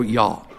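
y'all ending on the vowel, no L; Southern "y'all". Shown as a demonstration. Native correcting Yankees, y'all with silent L. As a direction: leave the L off y'all. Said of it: "Don't put an L on it".

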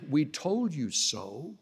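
soft, slow, drawn-out told-you-so. Acted. Israelites remind Moses of their warning, slow told-you-so. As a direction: say it low-key and knowing, stretched out. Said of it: "That's another way of saying".

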